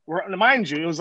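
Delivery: usual speaking voice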